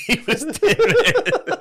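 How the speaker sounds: high, slow, giggling words; real laughter through the words; laughing. Real, not acted. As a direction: laugh the line out in delight. Friend laughing at dance story.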